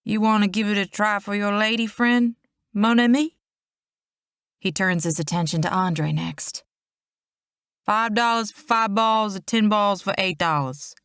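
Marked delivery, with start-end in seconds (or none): 0.0-3.4 lightly Cajun, rising on mon ami; a sly carnival vendor, a hint of Cajun; Cajun accent. A narrator's reading. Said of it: "a strong Cajun accent". 7.8-11.0 put-on Cajun accent. A narrator's reading. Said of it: "a strong Cajun accent".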